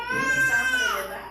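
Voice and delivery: high, loud sob through the words; real tears breaking the voice as she retells it; crying. Real, not acted. Woman retelling her reunion with the dog, crying for real. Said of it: "llorando también".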